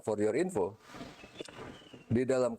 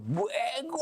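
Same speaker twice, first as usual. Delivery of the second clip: Bego snapped out high and sharp; sharp insulting bego. Shown as a demonstration. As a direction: fling Bego as a real insult, pitch jumping way up. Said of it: "gue marah orang kan".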